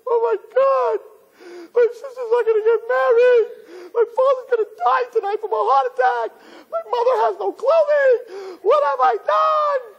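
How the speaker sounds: very high, loud, hammed-up crying; wailing child. Guilt-stricken kid after a fifty, melodramatic wailing. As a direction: sob it out in melodramatic despair. Kid despairing at parent's rant.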